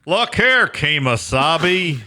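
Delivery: high, drawn-out cowboy impression with a laugh; John Wayne. Comedian riffs on westerns in a cowboy-star impression. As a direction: stretch the last word like a western star. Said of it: "I've heard that impersonation".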